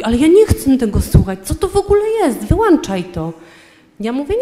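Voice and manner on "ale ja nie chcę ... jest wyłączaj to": high, fast, hammed-up outburst at the music; angry husband quoted. Husband yelling at wife to switch off the hymn, high and fast. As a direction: snap it out like the furious husband.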